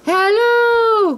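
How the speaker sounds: high, loud drawn-out hello; cried out. A narrator's reading. The voice in the tree calling to Pooh again. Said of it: "cried the voice again".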